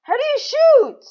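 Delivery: high, loud yell of frustration; frustrated shout. Real, not acted. Player who can't work the controls, frustrated shout.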